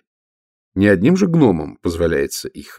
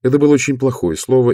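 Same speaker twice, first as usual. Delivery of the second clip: usual speaking voice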